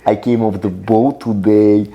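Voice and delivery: slow, put-on Russian immigrant accent; mock Russian accent. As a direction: play the fresh-off-the-boat Russian. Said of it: "the Russian accent".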